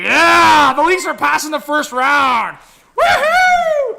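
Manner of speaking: high, loud victory cheer; jubilant shout. Real, not acted. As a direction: shout it like your team just won. Celebrating the dog's high five.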